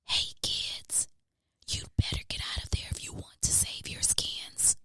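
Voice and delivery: soft urgent whisper; cat whispering to the trapped puppies. A narrator's reading.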